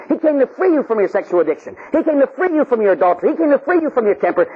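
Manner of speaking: high, driving preacher cadence; impassioned preaching. Acted. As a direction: build a preacher's list, each item hammered. Preaches at full force.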